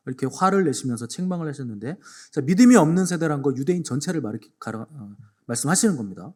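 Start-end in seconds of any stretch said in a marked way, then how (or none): none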